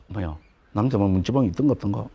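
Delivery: low, quick, unsure musing; genteel Seoul person. Acted. Lecturer mimics a genteel Seoul person wondering, low and hesitant. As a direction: wonder it aloud with mild, reserved doubt. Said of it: "점잖은 사람이".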